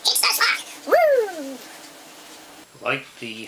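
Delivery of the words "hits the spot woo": high falsetto. Pretend. Echoing the clip's catchphrase.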